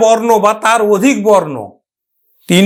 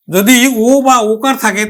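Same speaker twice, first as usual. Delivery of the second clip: ordinary talk, nothing marked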